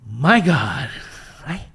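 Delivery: exasperated loathing. Retold or imitated. Him stuck in a meeting he hates, exasperated. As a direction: act out squirming dread. Miserable on edge of meeting seat.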